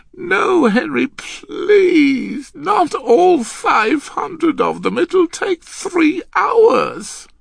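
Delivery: very high, loud, drawn-out plea; wailing girl. Acted. Girl begging Henry to shorten the practice, distressed. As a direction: wail in a girlish voice.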